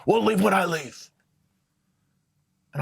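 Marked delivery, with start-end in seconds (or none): 0.1-1.1 hammed-up mom snapping at her son; angry mom. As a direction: play the furious mom pinning him to the wall, voice up high. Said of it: "I was like".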